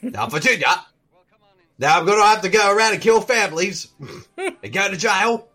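hammed-up movie tough-guy impression; Bronson character. Co-host riffing on a Tom Hardy remake, mock Bronson voice. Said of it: "play his Charles Bronson character from Bronson".

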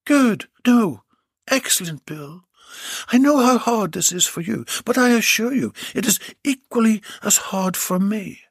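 high, commanding woman doctor; a lightly voiced stern doctor; bossy doctor voice. Doctor speaks to Bill.

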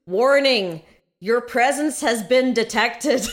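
loud, slow mock Northern Irish alarm announcement; terrible Northern Irish accent. Imitating the security alarm across the street in a hammed-up loud, slow Northern Irish accent. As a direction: boom it out slowly like a robotic Northern Irish security warning. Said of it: "'cause that's a terrible accent".

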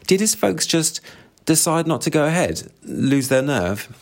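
high, slowing prying question; pry like a clueless busybody; tactless nosy woman. Acted.

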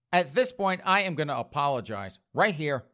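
ordinary talk, nothing marked

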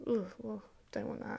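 soft, whispered refusal; disdainful mum. Retold or imitated. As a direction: turn it down in a hushed, sniffy way. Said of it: "Even my mum's a bit like".

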